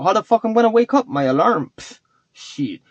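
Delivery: usual speaking voice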